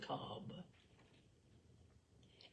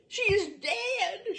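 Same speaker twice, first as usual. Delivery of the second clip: much higher, louder, slow scream breaking into a cry; sobbing scream; 13 semitones higher, 9 dB louder, slower than usual. A narrator's reading. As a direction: wail it again, slowly, voice cracking into tears. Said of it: "screamed Mary".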